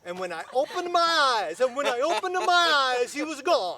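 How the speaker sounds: loud, high shout over laughter; shouted punchline. Real, not acted. Storyteller finishing his joke over the others' laughter, shouting. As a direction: belt out the punchline above the laughing.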